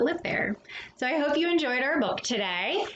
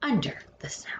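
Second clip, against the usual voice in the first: hushed whisper, trailing off; 7 semitones lower than usual. Acted. Narrator to young listeners, whispering the refrain. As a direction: breathe it like a secret beneath the snow.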